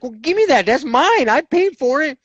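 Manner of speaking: high, pushy and eager; snatch it, it's yours; eager grab. Retold or imitated. Grabbing the pipe from the brother-in-law, eager and pushy.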